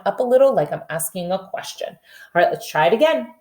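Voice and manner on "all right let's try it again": normal talking voice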